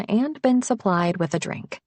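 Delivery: ordinary delivery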